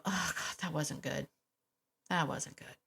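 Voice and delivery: soft, low, sinking regret; hushed self-reproach, voice dropped; regretful. Acted. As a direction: act out quiet remorse after an outburst. Acted owner regretting the outburst.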